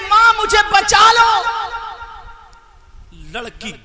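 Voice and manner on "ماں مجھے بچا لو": cry for help. Acted. Daughter screaming to her mother for rescue, high fast shout. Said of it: "اور یہ کہہ رہی ہے".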